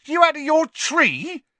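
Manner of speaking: a very high, loud, startled yelp. A narrator's reading. Stan yelps at Harry for saying the name, very high and loud. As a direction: yelp it in shock. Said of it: "yelped Stan".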